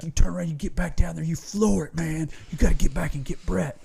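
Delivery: urgent orders. Retold or imitated. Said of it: "He's like".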